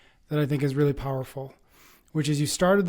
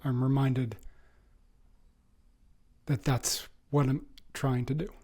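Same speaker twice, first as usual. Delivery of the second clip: emotional, near tears; 4 dB quieter, slower than usual. Real, not acted. Host moved by the guest's story, thanking him in a choked whisper. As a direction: voice sinking into a choked, breathy whisper. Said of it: "I'm getting emotional".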